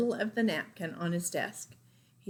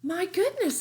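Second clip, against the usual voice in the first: surprised mother; 11 semitones higher, 4 dB louder than usual. Acted.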